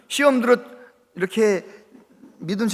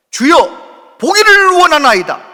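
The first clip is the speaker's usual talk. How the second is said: louder, higher cry of "보기를 원하나이다"; loud desperate cry; 7 semitones higher, 8 dB louder, slower than usual. Retold or imitated. Blind Bartimaeus crying out to Jesus, loud desperate plea. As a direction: shout the plea with everything. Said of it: "정확하게 자기의 기도를 딱 하는 거예요".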